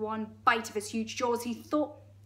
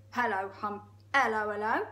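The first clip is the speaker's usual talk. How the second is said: hello in a misfired hippo voice; wrong character voice; 4 dB louder than usual. Acted. Trying out Humpy Rumpy's hello, wrong voice. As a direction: greet with a rising tail, accent not yet found. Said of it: "Sorry, that's the wrong voice".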